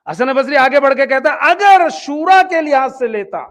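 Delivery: usual speaking voice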